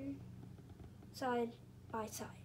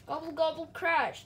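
loud refrain; 3 semitones higher, 6 dB louder than usual. A narrator's reading. Refrain read with gusto.

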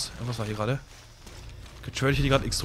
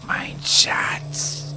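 a light, whispered Gollum hiss; mock creepy Gollum whisper. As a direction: breathe it like a hint of Gollum. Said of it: "Wow, Gollum".

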